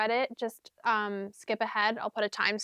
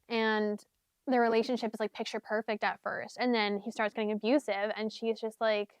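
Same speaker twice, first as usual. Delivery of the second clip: usual speaking voice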